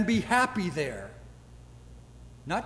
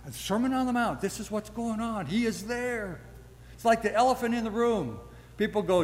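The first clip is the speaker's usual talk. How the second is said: ordinary talk, nothing marked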